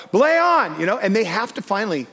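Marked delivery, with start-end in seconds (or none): 0.1-0.8 high, loud holler. Retold or imitated. Belayer hollers back to the climber, hollering. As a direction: call it up so it carries. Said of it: "And then I holler out".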